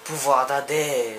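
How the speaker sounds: slow, drawn-out oracle style; measured, portentous reading; solemn game text reading. Acted. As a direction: intone it like an ancient prophecy. Reads game's mystic text aloud.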